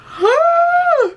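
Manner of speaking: sharp high gasp of delight; loud thrilled gasp; excited gasp. Real, not acted. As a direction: suck in air like great news just landed. Just got approved for book.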